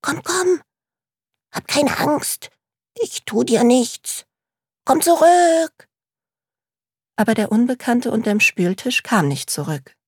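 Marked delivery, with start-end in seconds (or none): 0.0-5.9 gently coaxing. Acted. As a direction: lure a shy creature out kindly. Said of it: "lockte leise". (sanft lockend)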